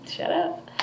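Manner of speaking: breathy, high whispered brush-off; playful whisper. Retold or imitated. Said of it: "So I just said".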